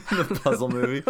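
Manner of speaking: laughing. Real, not acted. Laughing at the idea.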